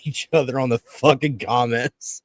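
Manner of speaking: ordinary delivery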